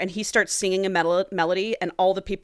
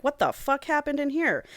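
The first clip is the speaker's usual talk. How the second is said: high-pitched, incredulous mock shock; shocked outburst; 8 semitones higher than usual. James walks into the bloodbath on the blimp, shocked.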